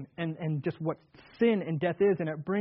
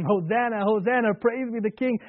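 crowd crying out; 4 semitones higher, 5 dB louder than usual. Retold or imitated. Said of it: "As they cry out to him".

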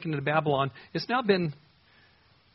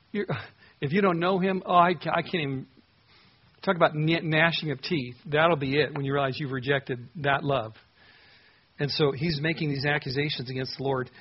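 ordinary delivery

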